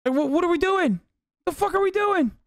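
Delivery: high-pitched exasperated cry; frantic disbelief. Acted. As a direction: act out panicked bewilderment. Acting out a frantic husband.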